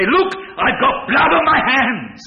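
a king's horrified outcry at his own guilt; anguished cry. Acted. David's anguished confession, preacher voicing.

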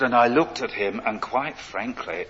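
ordinary talk

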